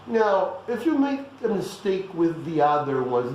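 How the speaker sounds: ordinary talk, nothing marked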